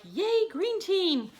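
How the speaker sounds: high, slow mock cheer; stretched-out mock cheer for the team. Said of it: "they were like".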